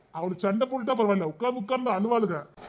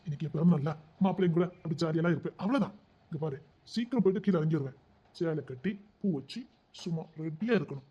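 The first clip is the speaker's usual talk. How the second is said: usual speaking voice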